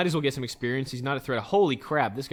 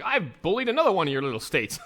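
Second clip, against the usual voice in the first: mock gloating leader; 7 semitones higher, 6 dB louder than usual. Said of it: "He's like".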